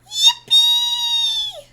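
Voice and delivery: very high, loud squeal of joy; squealed yippee. Real, not acted. Cheer to viewer.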